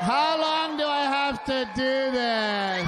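high, slow whining wife voice; mock whiny wife. Mocking his bored wife asking how long, high and slow.

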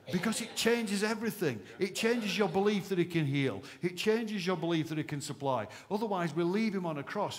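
loud, high, rousing preacher's build; preaching at full force. Real, not acted. Pastor rallying his church, full-force preaching.

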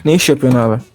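normal talking voice

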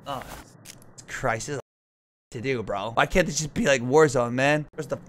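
usual speaking voice

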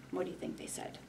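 soft whispered question to listeners; whispering. Acted. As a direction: whisper it to the kids. Aside to the children.

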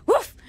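loud high imitated woof; dog bark. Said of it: "your dog just goes".

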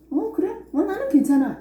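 light, high, carefree "it's fine"; mock-casual shrug in the voice; breezy unbothered reply. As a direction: toss it off like nothing matters. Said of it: "이렇게 하지만".

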